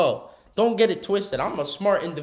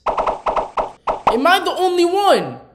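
incredulous; 11 semitones higher, 5 dB louder, much slower than usual. Real, not acted. Asking viewers, incredulous.

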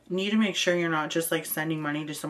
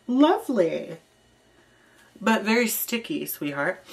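sarcastic; 4 semitones higher, 7 dB louder than usual. Real, not acted. Teasing reply to chocolate message.

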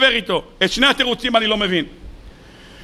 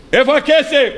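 higher, louder greedy demand; demanding Laban; 4 semitones higher, 3 dB louder than usual. Acted. Laban demands Jacob's money, higher and louder. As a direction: pester him for the money.

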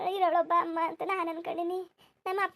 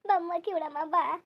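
lamenting village woman; 3 dB louder, slower than usual. Acted. Mourner recalling the dead woman's kindness, lamenting. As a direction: wail and reproach through the lament. Acts lamenting a sudden death.

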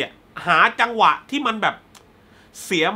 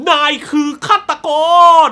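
dramatic accusation; 8 semitones higher, 8 dB louder than usual. Retold or imitated. Detective concluding to the suspect that he is the killer, loud dramatic accusation. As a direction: point out the killer at full voice. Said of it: "พูดสรุปเลยว่า".

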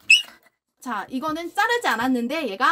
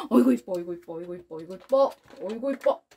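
doting coos; 3 semitones lower, faster than usual. Real, not acted. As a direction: coo at the puppy fondly. Doting on dog after kiss.

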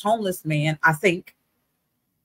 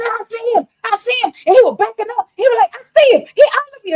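high, loud mock shout; retold scream; 7 dB louder than usual. Homeless man shouting as he backs away, high mock yell. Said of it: "because he was screaming it".

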